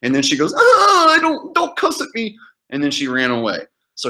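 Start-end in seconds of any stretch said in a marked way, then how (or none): 0.5-2.4 very high, hammed-up alarm; exaggerated falsetto squeal of offence; mock offended woman. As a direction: imitate a scandalized woman shrieking. Imitates offended woman on phone.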